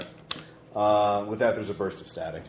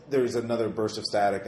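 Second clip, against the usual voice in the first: normal talking voice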